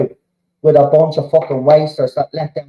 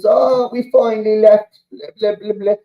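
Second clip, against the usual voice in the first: lightly higher mocking fan group; higher, dismissive parody of a fan group statement; mock announcement; 7 semitones higher than usual. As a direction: rattle it off dismissively, trailing into blah. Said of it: "They try and make an announcement".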